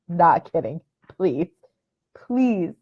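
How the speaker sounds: ordinary delivery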